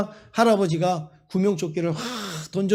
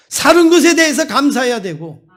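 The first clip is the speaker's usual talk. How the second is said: preaching at full force; 3 semitones higher, 5 dB louder than usual. Acted. Pastor urging his congregation to be grateful, in full-force preaching. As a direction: press every line on the congregation. Preacher drives home the lesson.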